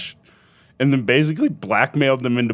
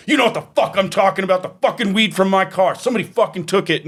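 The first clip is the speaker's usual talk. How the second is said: fast, higher mock rant of a dad; mock angry dad; 8 semitones higher, faster than usual. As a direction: rattle it off like the dad chewing them out. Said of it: "And he's like".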